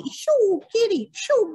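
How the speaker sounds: high, playful "shoo" whooshes for dodging; whoosh sounds. Pretend. Storyteller to children acting David dodging, high playful whooshes. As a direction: swoosh like something darting past.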